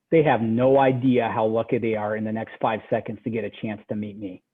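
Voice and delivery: low, hushed self-assurance, trailing away; quiet confidence. Retold or imitated. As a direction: say it low, as if privately to yourself. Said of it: "a little bit of, uh, confidence".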